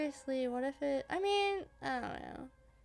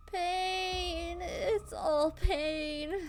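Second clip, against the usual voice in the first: hammed-up agony; 5 semitones higher, 4 dB louder, much slower than usual. Character groans in pain, dramatic.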